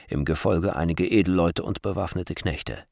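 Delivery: usual speaking voice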